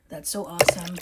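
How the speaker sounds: ordinary talk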